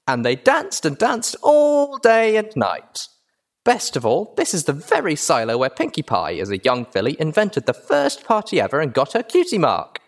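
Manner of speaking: put-on high, gushing child presenter; bubbly high-pitched filly spiel; excitable filly tour guide. A narrator's reading. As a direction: show off like a thrilled little girl.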